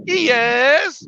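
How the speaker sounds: high put-on British accent; mock British accent. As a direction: imitate someone passing it off as British. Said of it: "a British word".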